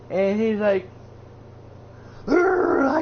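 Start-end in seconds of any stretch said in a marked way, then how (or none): 2.3-3.0 high, loud frustrated growl; mock furious growl of a wrestler; mad grunt. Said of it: "like, all mad".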